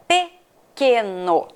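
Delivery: pequeno broken into separate syllables. Shown as a demonstration. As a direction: dictate pequeno slowly, pe, que, no. Sounding out syllables to be highlighted.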